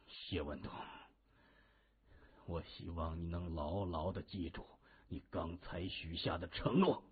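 put-on low, soft old man's warning; solemn old man. A narrator's reading. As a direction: weigh each word, deep and quiet. (低沉郑重地警告)

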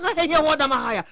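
loud, high cry of fright; frightened outcry. Acted. Old man looks up at Ma and bolts, frightened outcry. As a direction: yelp in alarm, hammed up.

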